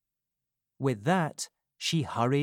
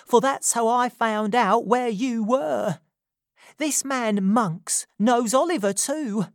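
put-on higher, louder, urgent young woman; Nancy risking her life telling Rose the truth, urgent and louder; 10 semitones higher, 5 dB louder than usual. A narrator's reading. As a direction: confess it as if your life depends on it.

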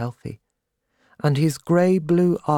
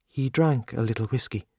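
ordinary delivery

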